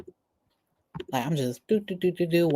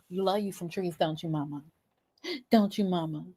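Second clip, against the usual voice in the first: soft cooing to the cat; softer loving pet talk; tender pet talk; 7 dB quieter than usual. Real, not acted. Coos to her cat.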